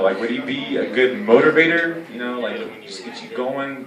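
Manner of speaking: normal talking voice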